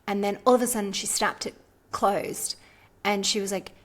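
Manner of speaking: ordinary delivery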